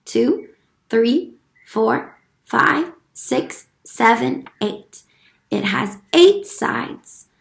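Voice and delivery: ordinary delivery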